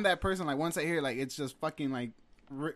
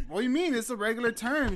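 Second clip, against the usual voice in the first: loud, high retold protest; pleading mock indignation, pitched up; defensive protest; 9 semitones higher, 6 dB louder than usual. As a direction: act out defending yourself, voice raised. Acting out his defensive comeback.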